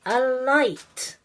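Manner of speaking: loud ringing magic command; commanding call. Acted. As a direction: call the spell out proudly. Queen Fairy casts a spell.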